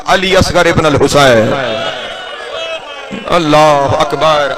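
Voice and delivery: measured mourning recitation, unhurried; majlis recitation. Acted. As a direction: recite the tale of grief to the gathering.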